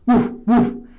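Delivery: two loud woofs; dog barks. Acted. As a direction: bark like a dog. Imitates the dog.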